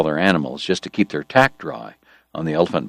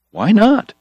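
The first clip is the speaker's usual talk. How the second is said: casual question; 4 dB louder than usual. Acted. Morg's quick reply.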